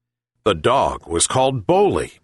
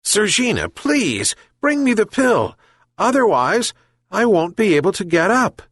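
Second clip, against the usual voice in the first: sad plea; 11 semitones higher than usual. Acted. Sick old man sadly begs the cat again, high pleading. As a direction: beg again, close to despair. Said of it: "sadly repeated the old man".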